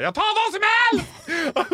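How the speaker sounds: high, loud, slow panicked yell; desperate last-minute shopper bellowing; panicked shout. Retold or imitated. Panicked man shouting at entrance.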